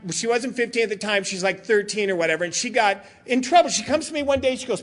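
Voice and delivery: ordinary talk, nothing marked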